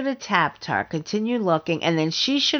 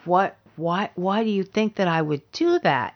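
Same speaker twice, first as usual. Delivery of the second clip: ordinary talk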